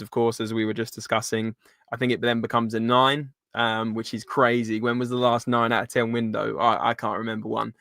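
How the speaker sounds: normal talking voice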